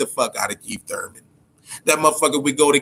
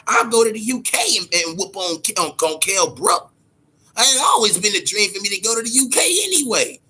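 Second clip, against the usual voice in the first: mocking Thurman; 6 semitones higher than usual. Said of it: "That's why he said it".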